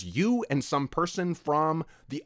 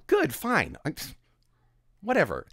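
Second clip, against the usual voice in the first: higher huffy mock brush-off; mock sulky; 8 semitones higher than usual. Mocking commenters' huffy brush-off, higher sulky voice. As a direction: sulk like a brat.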